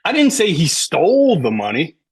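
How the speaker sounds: emphatic stole, as if another verb is true; stress on STOLE. Shown as a demonstration. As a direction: punch and stretch the word stole. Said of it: "if you emphasize certain words".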